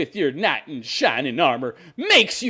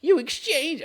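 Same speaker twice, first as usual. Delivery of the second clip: dopey female voice; 12 semitones higher, slower than usual. Retold or imitated. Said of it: "I don't wanna do the female voice".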